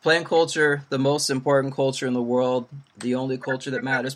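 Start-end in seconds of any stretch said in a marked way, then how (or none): none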